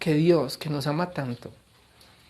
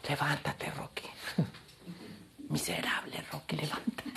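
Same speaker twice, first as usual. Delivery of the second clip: hushed, strained half-whisper; mock whisper; 2 semitones lower, 12 dB quieter than usual. God answering the complaining pastor like Rocky's coach, mock whisper. God's weary reply as the coach.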